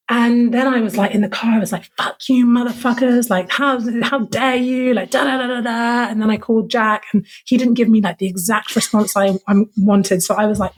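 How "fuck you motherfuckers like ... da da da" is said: higher-pitched mock outburst at absent offenders; worked-up, indignant ranting; retold angry rant. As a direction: re-enact venting alone in the car. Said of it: "and so angry".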